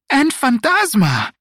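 high, bright, loud greeting; cheerful. Acted. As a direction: call out the name with delight. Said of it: "added with a warm smile".

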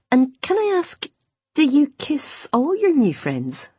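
high, lilting playful question; coy teasing. A narrator's reading. Imogen asks Owen about kissing friends, high and coy. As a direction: draw out the question with coy mischief.

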